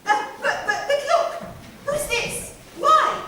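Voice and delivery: high flustered stammer with a laugh; surprised. A narrator's reading. Narrator feigned surprise.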